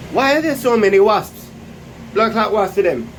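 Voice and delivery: loud, high-pitched outburst; exasperated shout. Real, not acted. Cook cursing wasps in the kitchen, exasperated shout. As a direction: shout your annoyance at the intruders.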